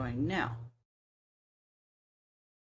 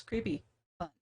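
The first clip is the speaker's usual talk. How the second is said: sarcastic. Real, not acted. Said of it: "Sarcasm intended".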